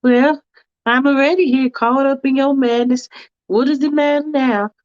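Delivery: a fussy woman hedging politely; Faith's fussy voice. Acted.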